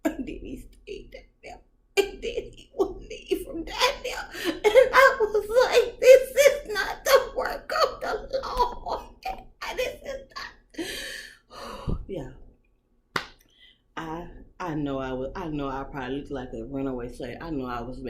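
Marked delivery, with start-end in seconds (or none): 2.0-12.0 high, loud, slow, laughing through it; laughing squeal. Real, not acted. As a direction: squeal the words mid laugh.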